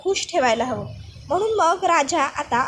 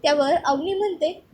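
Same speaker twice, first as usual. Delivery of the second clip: ordinary delivery, nothing marked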